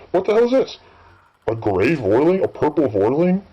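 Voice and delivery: sudden high jump of surprise; genuinely startled by something new; real surprise. Real, not acted. Gamer spotting a purple Vorling, sudden high surprise. Spots a strange creature in game.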